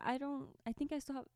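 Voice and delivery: ordinary talk, nothing marked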